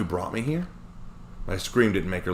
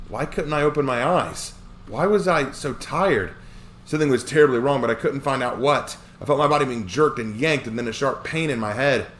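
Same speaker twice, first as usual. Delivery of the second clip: panicked; 6 semitones higher, 4 dB louder than usual. Acted. Growing panic narration.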